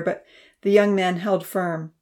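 ordinary delivery

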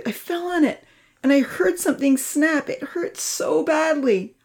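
put-on high-pitched, hurting child; pained distress. A narrator's reading. Charlie explains his hurt arm, in pained distress.

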